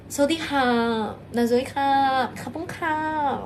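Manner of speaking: very slow mock Thai phrases. Shown as a demonstration. Viewer asks for Thai, streamer does very slow mock Thai. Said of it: "Coba Thailand".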